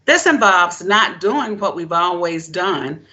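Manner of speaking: ordinary delivery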